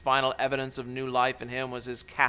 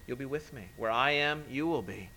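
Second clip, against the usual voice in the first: usual speaking voice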